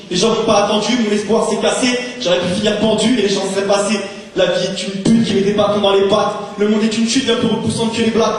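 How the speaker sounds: rising rage in rhythmic verse; angry slam outburst. Acted. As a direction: build to an angry climax, pitched high and driven. Slam poem climaxes in rage.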